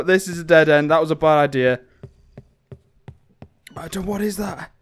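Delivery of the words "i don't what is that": hushed, softer whisper; fearful whisper. Real, not acted. Player spotting something unknown, whispering nervously. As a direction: whisper it warily.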